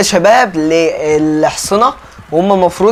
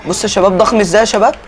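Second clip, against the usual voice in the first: ordinary delivery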